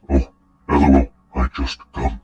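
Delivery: halting mock caveman; Neanderthal. Commentator reading out a weirdly typed message, mock Neanderthal. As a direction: imitate a caveman, word by separate word. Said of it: "Like some fucking Neanderthal".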